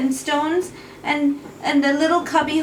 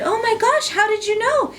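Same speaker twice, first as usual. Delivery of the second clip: high, surprised gasp of a question; be startled the witch knew; amazed; 9 semitones higher than usual. Acted. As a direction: Apachu is amazed the witch expected him, high-pitched surprise.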